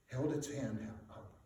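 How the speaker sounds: ordinary delivery, nothing marked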